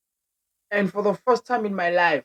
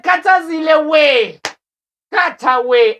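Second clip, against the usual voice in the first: drawn-out fond call, louder; loud slow call; 7 semitones higher, 6 dB louder, slower than usual. Retold or imitated. Grandson calling out the pastor's name to grandma, loud and slow. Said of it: "I'm like".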